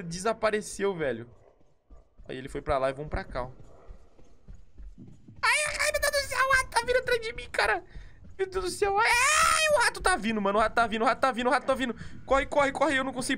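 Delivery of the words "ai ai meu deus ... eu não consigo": shrill panicked screaming, far higher and louder; being chased. Real, not acted. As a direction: scream and flee in terror. Rat chasing him. (gritando em pânico perseguido)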